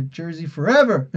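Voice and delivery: lightly put-on superhero rallying call; a hint of a movie-hero impression.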